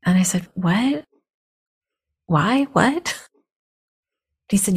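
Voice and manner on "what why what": higher, rising questions; baffled. Retold or imitated. Student questions teacher's odd ban, baffled. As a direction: disbelief climbing on each short question. Retold bafflement at being forbidden.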